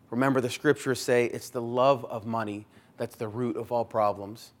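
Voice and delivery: usual speaking voice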